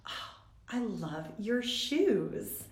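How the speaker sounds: soft, very slow, sugary compliment; sweetest voice. Retold or imitated. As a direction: coo it sweetly and slowly. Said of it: "in the sweetest voice goes".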